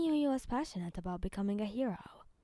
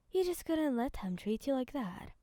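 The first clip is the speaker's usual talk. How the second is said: ordinary delivery, nothing marked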